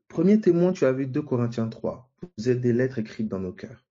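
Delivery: ordinary delivery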